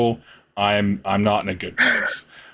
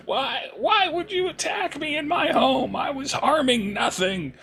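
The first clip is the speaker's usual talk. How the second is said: much higher, fake-pitiful whimpering plea; weeping hag. The sea hag begs the party, fake weeping and high-pitched. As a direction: play the wronged hag, blubbering for mercy. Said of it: "the hag starts weeping and blubbering".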